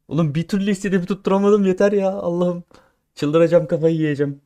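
venting at a failed upgrade, going mad; half-laughing frustrated yell; exasperated outburst. Real, not acted.

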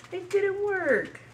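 high, slow mock whimper; mock sad. Real, not acted. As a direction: whine it out, hammed-up. Failed tail pull.

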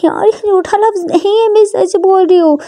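high-pitched, earnest insistence; protesting plea. Acted. Ishani insists her endearment is true, protesting.

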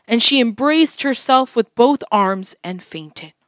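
ordinary delivery

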